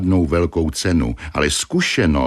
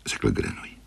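ordinary delivery

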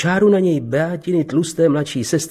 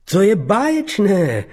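put-on delight, higher and drawn out; insincere delight; 7 semitones higher than usual. A narrator's reading. Bernard greets Beata at the carriage, feigning delight. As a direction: stretch out the praise as if thrilled, without meaning it. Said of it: "pronesl neúpřímně".

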